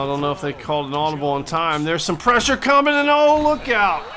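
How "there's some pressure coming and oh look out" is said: excited call. Acted. Commentator calling the blitz, excited shout. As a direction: voice jumps up, loud and higher. Pass rush bearing down.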